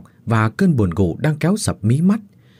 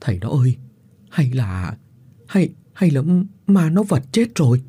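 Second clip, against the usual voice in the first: stammering fear; 5 semitones higher than usual. A narrator's reading. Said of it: "tiếng lắp bắp của bà Thủy".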